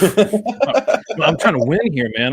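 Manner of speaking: laughing through the words. Real, not acted. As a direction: breaks into real laughter while answering. Laughing reply to teasing.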